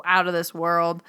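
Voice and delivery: normal talking voice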